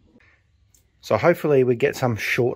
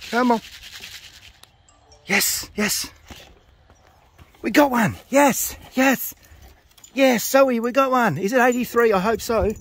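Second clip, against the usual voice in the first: excited cheering; 11 semitones higher than usual. Real, not acted. Fancier cheering to Zoe as the bird clocks, thrilled.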